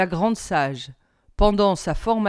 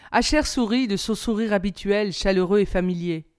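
plain reading voice

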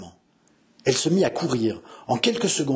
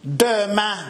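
higher, drawn-out bitter echo; dying old man; 8 semitones higher, 3 dB louder than usual. Acted. Dying lord echoing the suitor's promise, despairing old man's voice. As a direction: repeat it in despair.

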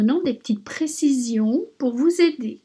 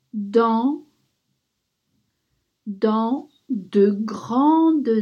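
dictating. Shown as a demonstration. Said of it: "Maintenant, je vais vous la dicter".

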